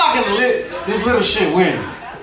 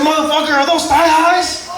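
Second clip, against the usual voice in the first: high, slowed disbelief at the socks; hammed-up astonishment; mock shocked gang friend; 7 semitones higher than usual. Gang friend Marcos shocked by the long socks, mock disbelief. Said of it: "He said".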